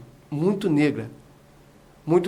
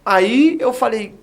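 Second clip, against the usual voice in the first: usual speaking voice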